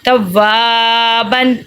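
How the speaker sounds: توابن with a long sustained "waa", short "ban"; lengthened middle vowel. Shown as a demonstration. Teacher repeating the model reading, drawn-out middle vowel. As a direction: stretch the middle of توابن, keep the ending short. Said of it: "اسی لیے یہ ہم پڑھیں گے".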